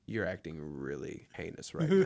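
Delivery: low, measured, proper cat voice; proper talking cat. Pretend. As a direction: speak as a disdainful, very proper cat. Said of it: "cats talk with the most proper".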